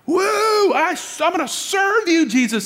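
hammed-up high-pitched whooping zeal; cavalier enthusiasm. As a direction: squeal it like an overeager follower. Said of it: "we get a little cavalier".

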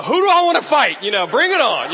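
loud, high mock challenge; hammed-up swagger, calling them out; bravado. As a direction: play pumped-up fighting talk. Retold sudden bravado after being defended.

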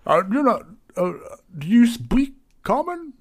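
high, loud, hesitant question; delicately baffled, voice pushed up; puzzled tavern keeper. Acted. Barry puzzled by foreign customer.

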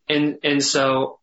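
ordinary talk

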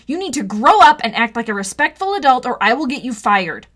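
furious ultimatum; angry threat. Acted. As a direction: threaten him hard. Threatening the bully.

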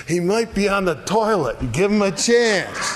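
high-pitched sarcastic jeer; mock taunting. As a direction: act out poking fun at rivals. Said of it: "he's poking fun at him".

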